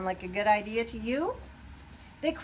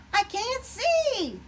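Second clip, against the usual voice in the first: blinded turkey; 6 dB louder, much slower than usual. A narrator's reading. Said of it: "Ollie said".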